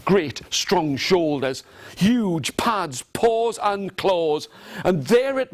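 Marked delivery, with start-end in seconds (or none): none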